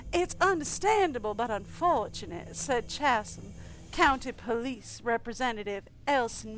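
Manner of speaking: steady newsreader delivery of the article; a lightly voiced news anchor; news read. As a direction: read it like a local news report. Reads police quote in article.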